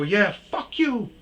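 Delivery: imitating himself yelling; retold yelling. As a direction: yell it while getting out of the car. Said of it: "I'm yelling".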